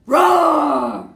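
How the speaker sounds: big loud roar; hammed-up lion roar. Lion jumps up at woman.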